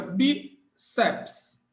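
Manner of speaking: BICEPS broken into "bi", pause, "ceps"; split by syllables. Shown as a demonstration. Teacher shows students how biceps divides, split by syllables. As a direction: say "biceps" slowly, one syllable at a time. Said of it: "em qual sílaba?".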